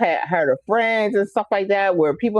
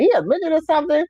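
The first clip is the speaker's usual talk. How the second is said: high, fast mock of the aunt's country accent; 10 semitones higher, much faster than usual. Imitating her aunt asking about her short nephew. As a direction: ham up the aunt's blunt question. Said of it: "with her little accent".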